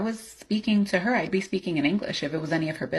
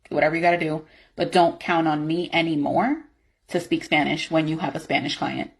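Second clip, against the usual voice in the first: angry; 9 dB louder than usual. Acted. Refuses Spanish call, heated argument.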